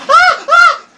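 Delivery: high shrieking re-enactment of fright; imitated scream. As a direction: replay the scream he let out. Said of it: "boom, I was like".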